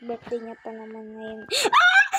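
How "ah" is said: high loud hammed-up wail; mock wail. Vlogger wailing in mock heartbreak over her crush. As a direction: let out a fake cry of despair.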